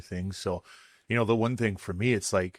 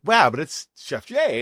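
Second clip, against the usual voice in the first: mock surprised protest; 6 semitones higher, 6 dB louder than usual. Retelling his reply to guests.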